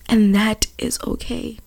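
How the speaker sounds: very soft, tender retelling; almost hushed, comforting; gentle reassurance. Retold or imitated. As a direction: reassure quietly, as gran did. Gran's reassurance, retold tenderly.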